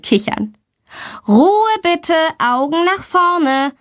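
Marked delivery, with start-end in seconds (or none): 1.3-3.7 high-pitched, drawn-out call to order; admonishing teacher. A narrator's reading. Teacher calls two giggling girls to order, admonishing. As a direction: call the class to attention, high and slow. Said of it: "ermahnt sie die Lehrerin".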